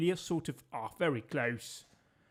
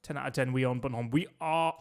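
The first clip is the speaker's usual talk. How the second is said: normal talking voice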